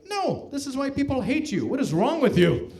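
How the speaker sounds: mild outburst, jumping up in pitch; a touch of hammed-up scolding; mock indignation. Canadian to American offering a joke, mock-indignant.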